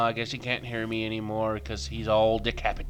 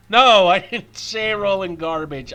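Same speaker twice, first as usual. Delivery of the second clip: loud, raised acted telling-off; exasperated mock scolding; 7 semitones higher, 10 dB louder than usual. Owner correcting a dog that misheard, exasperated mock scolding.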